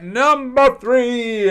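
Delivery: game-show countdown voice; announcer call. Real, not acted. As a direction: boom out the number like a show host. Countdown host announcing next entry.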